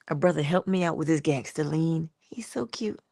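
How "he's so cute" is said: soft doting whisper. Real, not acted. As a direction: whisper it fondly about the bird. Doting on her bird.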